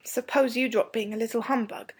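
mild, good-humoured retort; light, uncross boy character; not cross. A narrator's reading. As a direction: tease back without any crossness. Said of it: "but not crossly".

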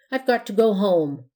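muffled under a pillowcase. A narrator's reading. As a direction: talk through cloth. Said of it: "in a muffled tone".

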